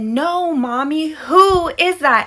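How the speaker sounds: put-on high, stretched child voice; singsong little-kid insistence; insistent toddler. Son insists again.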